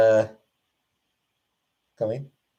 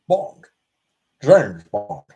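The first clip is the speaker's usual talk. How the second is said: loud, punchy movie-spy introduction; hammed-up impression, name punched out; Sean Connery; 2 semitones lower, 7 dB louder, much slower than usual. Streamer imitating a famous actor on a viewer's request, hammed-up impression. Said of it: "Imitate Sean Connery".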